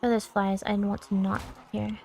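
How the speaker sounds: ordinary talk, nothing marked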